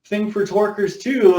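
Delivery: high, eager, retold excitement; excited reply. Telling David he also loves Torkers, excitedly. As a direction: perk up about Torkers, pitch jumping high. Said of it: "And I'm like".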